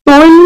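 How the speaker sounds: তৈল called out high and loud, "toil"; emphatic example word. Shown as a demonstration. Teacher calling out the example word loudly. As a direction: ring out তৈল to draw attention. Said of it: "শব্দের উচ্চারণ কি হবে".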